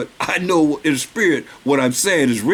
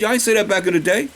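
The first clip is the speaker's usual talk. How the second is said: ordinary delivery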